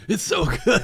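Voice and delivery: high, loud, through laughter; laughing. Real, not acted. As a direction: laugh right through the words. Laughing at friend's coughing fit.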